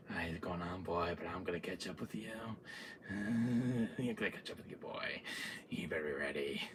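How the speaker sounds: low, soft villain drawn-in murmur; hushed, taunting menace; creepy threat. Retold or imitated. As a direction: murmur it like a patient stalker. Villain promises to catch up.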